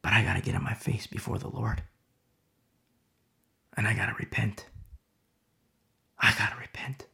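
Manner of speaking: soft, whispered resolve to repent; contrite whisper. Acted. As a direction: murmur it as if kneeling in shame.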